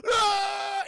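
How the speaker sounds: high, loud dying scream. Retold or imitated. Corey is eaten by the spider, loud scream. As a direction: scream as you get eaten alive. Character gets eaten alive.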